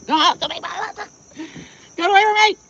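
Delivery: high, loud panicked yell; panicked shriek. Real, not acted. Vlogger scared of a bee on her foot, panicked shriek. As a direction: shoo away a bee in real fear.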